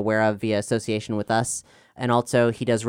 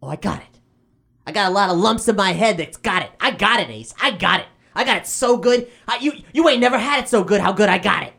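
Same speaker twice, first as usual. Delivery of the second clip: higher, snide and sarcastic; sarcastic kid brother; 8 semitones higher than usual. Acted. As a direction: sneer it back at him.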